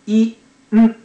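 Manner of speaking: イ、ン as two loud separate sounds. Shown as a demonstration. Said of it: "エヌがんですから".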